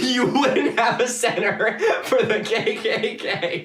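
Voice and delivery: high, slow, laughing through the words; incredulous laughter, pitch climbing; laughing in disbelief. Real, not acted. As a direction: break up laughing as you say it.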